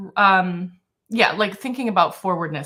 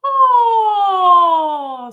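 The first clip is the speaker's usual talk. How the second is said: high, loud throaty yawn oh; 11 semitones higher, 10 dB louder than usual. Shown as a demonstration. Teacher demonstrating a throaty oh. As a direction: open the throat wide on oh. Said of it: "that's a little more throaty".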